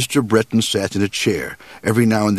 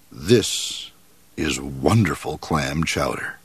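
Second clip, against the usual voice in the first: polite moose; 6 semitones lower, 4 dB quieter, slower than usual. Acted. Moose praising the cook's chowder, low and soft. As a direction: lower and softer, a grave, courteous moose.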